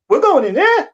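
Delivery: loud, high, with a laugh; can't believe they're going in; disbelieving question. Retold or imitated. Rookie asking his lieutenant about the burning building, aghast.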